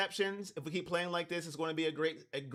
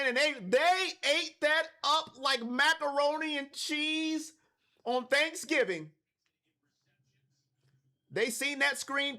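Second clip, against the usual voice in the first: live play-by-play; 5 semitones higher than usual. Real, not acted. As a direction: call the play with rising punch, stretching big phrases.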